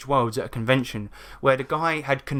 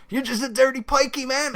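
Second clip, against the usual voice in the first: much higher put-on bro voice; high dumb dude sneer; 11 semitones higher than usual. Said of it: "being like".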